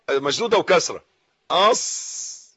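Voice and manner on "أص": "أص" breathed out slowly with no voice; silent sukun sound. Shown as a demonstration. Teacher isolating the sukun on ص for students, slow and breathy. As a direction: hold the ص of "أص" as a slow unvoiced hiss. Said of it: "دي السكون،".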